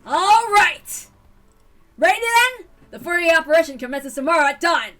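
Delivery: loud rallying call to the troops; rally the club like a general; commanding shout. Acted. Club leader announcing the operation, loud and commanding.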